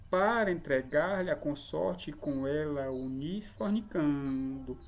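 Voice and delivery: slow, measured hexameter recitation; leitura métrica hexâmetra. Shown as a demonstration. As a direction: lean on each long syllable in a steady dactylic beat. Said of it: "É assim que se deve ler". (verso escandido devagar, com sílabas longas marcadas)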